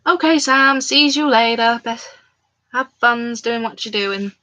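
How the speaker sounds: ordinary talk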